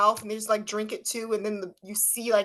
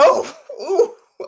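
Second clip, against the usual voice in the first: put-on louder whimpering baby sounds; mock toddler whimper; 8 semitones higher, 9 dB louder than usual. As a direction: imitate a child about to cry. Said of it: "She's like".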